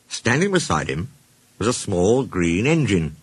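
usual speaking voice

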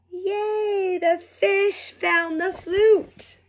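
cheering. Acted. Flute rescued.

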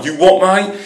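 broad cockney banter; cockney accent. Shown as a demonstration. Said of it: "I can be a fucking cockney".

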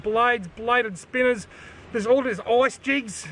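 usual speaking voice

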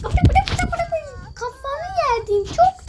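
high, loud chanting of kafa; excited cooing. Real, not acted. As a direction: gush over something cute. Cooing at cute avatar.

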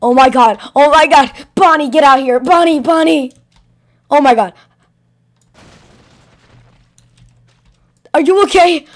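loud, frantic calling out; panicked shouting. Acted. Finding Bonnie hurt, calls out to her in loud panic.

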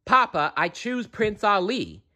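put-on high young princess pleading with Papa. A narrator's reading. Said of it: "cried Jasmine".

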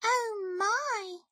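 high little mouse voice; gasped-over sympathy from a tiny mouse; small, high storybook mouse; concerned mouse. A narrator's reading. Mouse reacts to Bear.

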